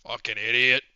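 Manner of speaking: normal talking voice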